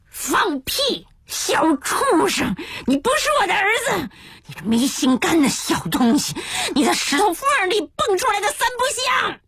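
high-pitched, strained enraged tirade; furious cursing. Acted. Father cursing his son from confinement, furious tirade. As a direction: spit abuse, voice rising to a screech. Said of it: "便骂出一串平日绝不出口的脏话".

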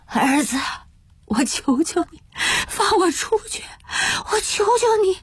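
hoarse, high-pitched sobbing plea; straining, voice failing. Acted. Father begging his sons to let him out, voice failing. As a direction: beg through a raw, breaking throat. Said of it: "嗓子已经痛得发不出声音".

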